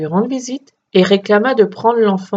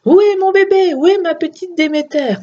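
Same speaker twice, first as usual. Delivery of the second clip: high, loud frantic mother's cry; panicked mother calling for her child; 11 semitones higher, 4 dB louder than usual. A narrator's reading. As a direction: cry out for the lost baby. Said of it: "cria la pauvre mère".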